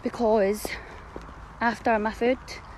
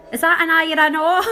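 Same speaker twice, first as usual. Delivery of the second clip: higher, louder imitation; raised, cheeky retold question; cheeky shout back; 6 semitones higher, 6 dB louder than usual. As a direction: call it out insistently from a corridor. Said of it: "pops his head out the corridor and goes".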